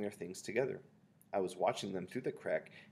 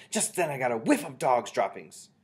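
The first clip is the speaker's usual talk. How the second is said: loud, higher excited report; yelled; 5 semitones higher, 10 dB louder than usual. A narrator's reading. Witch reporting a whiff to the others, yelled. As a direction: keep yelling, easing toward the end. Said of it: "she yelled".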